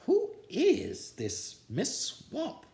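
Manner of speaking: a fussy man scratching his head; puzzled principal. A narrator's reading. Blansworth wonders who the new coach is, high slow puzzlement.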